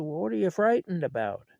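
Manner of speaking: loud, high-pitched incredulous question; retold yell. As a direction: imitate grandpa calling up in disbelief. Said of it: "he yells up at the kids".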